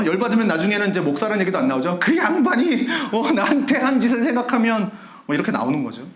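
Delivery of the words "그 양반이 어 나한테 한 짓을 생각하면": indignant rant. Retold or imitated. Member rants about pastor.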